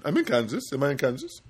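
put-on Indian accent, each phrase rising; questioning Indian accent. Imitated agent unsure of Kansas, questioning Indian accent. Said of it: "It sounds like he's asking a question".

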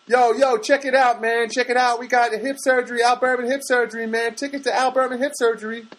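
high-pitched East Coast ticket hustler; put-on street hustler voice; street scalper hustle. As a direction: hawk tickets in a high, insistent patter.

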